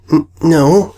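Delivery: stuttering. A narrator's reading. Sweetie stammers a frightened no to Diamond. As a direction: trip over the first sound. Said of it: "Sweetie stuttered".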